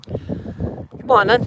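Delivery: high, slow drawn-out call; long sing-song call. Real, not acted. Calling the horses in.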